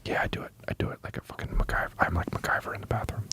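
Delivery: hushed mock ASMR; ASMR whisper. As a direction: close whispered question into the mic. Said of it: "Can you do some A S M R".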